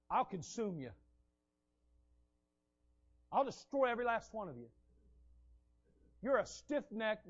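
normal talking voice

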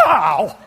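loud sudden "ow" from an electric shock; retold cry of pain; shocked yelp. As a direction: yelp as if zapped by a door. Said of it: "it shocked me".

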